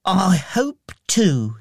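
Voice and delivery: lightly voiced small boy, lifted pitch; a hint of an earnest child; Tiny Tim. A narrator's reading. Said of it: "he said to Bob".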